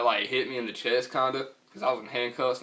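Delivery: ordinary talk, nothing marked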